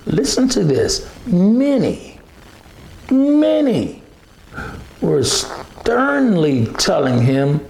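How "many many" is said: "many" pitched far higher each time; "many" soaring up, the second one highest; stress on many. Real, not acted. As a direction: climb high on "many, many". Preacher stresses the crowd.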